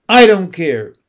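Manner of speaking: loud, tearful outburst; a boy yelling at his father through tears; crying defiance. A narrator's reading. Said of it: "He was crying now".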